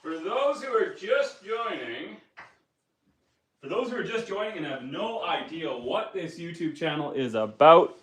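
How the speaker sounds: projecting back to the mic while walking; far-off voice across the house; called from afar. Real, not acted. Calling from across the room.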